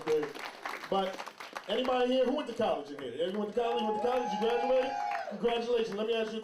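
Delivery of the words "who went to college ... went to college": ordinary delivery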